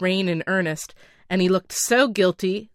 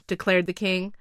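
ordinary delivery

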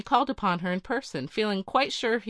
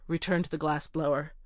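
plain reading voice

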